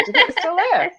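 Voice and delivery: usual speaking voice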